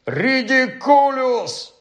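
high, long-drawn cry; roared spell. A narrator's reading. Pupil casts at the giant spider, high drawn-out roar. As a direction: bellow the spell, high and stretched. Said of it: "заревів Рон".

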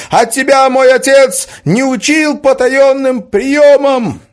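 shouted high and loud; furious shout. Acted. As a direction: yell the challenge at the top of the voice. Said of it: "— крикнул Роберт".

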